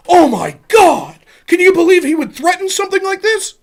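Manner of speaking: high, hammed-up scandalized gasp of words; mock shock. Streamer mimicking fans aghast at his complaint, mock shock. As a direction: feign horror, drawing out the exclamation.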